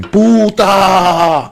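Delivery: loud high yell of Puta. Retold or imitated. Mother screaming at the neighbour, a loud high yell. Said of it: "She's out there yelling and screaming".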